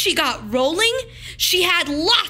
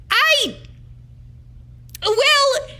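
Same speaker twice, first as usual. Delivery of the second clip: flustered clerk; 13 semitones higher than usual. A narrator's reading. Clerk stunned by a child's question, high flustered stammer. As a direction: caught off guard, sputtering. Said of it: "said the clerk".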